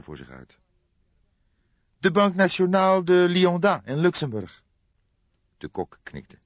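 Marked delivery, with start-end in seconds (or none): 2.0-4.6 a much higher, louder character voice for the detective; Fledder's voice. A narrator's reading. Detective Fledder tells the cook the bank's name, high and louder. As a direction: voice the inspector pitched well up and pushed forward.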